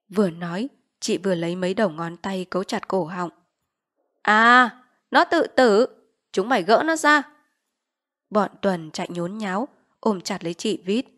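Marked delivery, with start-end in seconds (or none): 4.2-7.2 loud startled order; alarmed shout. Acted. Village chief seeing Vít choke herself, alarmed shout. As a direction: bark in sudden alarm.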